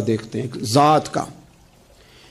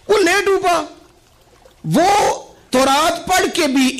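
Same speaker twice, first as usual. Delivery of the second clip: high declamation, easing down through the repeats; fervent preaching; 9 semitones higher than usual. Acted. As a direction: proclaim it high to the whole congregation. Preacher raises voice in sermon.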